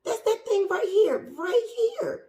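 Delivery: very high, excited squeal. Real, not acted. Plant owner pointing viewers to the fuzzy bit, squealing excitedly. Thrilled at fuzzy new growth.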